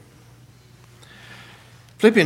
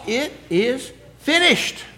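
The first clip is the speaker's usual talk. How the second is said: higher and slow, hushed; Jesus' last words; 7 semitones higher, slower than usual. Acted. Preacher voicing Jesus on the cross, hushed and slow. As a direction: let it out like a final breath.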